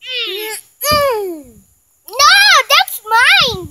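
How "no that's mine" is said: loud drawn-out no, that's mine; slow loud complaint; loud protest. Real, not acted. Sibling grabbing the candy.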